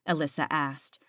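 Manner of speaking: ordinary delivery, nothing marked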